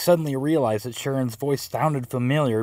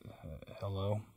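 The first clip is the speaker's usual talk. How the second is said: groggy, much softer, just woken up; deep sleepy voice; 3 semitones lower, 15 dB quieter, slower than usual. Pretend. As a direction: fake a half-asleep answer. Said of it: "I answered in a deep, sleepy voice".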